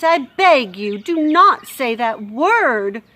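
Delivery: loud, slow earnest plea; pleading Louise. Acted. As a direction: beg her not to.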